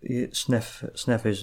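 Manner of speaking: usual speaking voice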